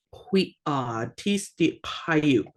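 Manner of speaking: slowly, drawing out the double d in "Qui odd"; drawn-out doubled sounds. Shown as a demonstration. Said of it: "you kind of draw it out a little bit".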